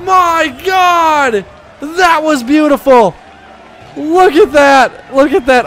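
loud, high whoops of disbelief; rising held cry bursting into awed yells; amazed shouting. Real, not acted. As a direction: ecstatic goal celebration. Celebrates a beautiful goal.

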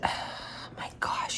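hushed whisper to the viewers; breathy aside, barely voiced. Real, not acted. Whispering to viewers about the paperwork. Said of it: "whispering to camera".

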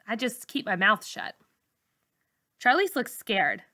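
usual speaking voice

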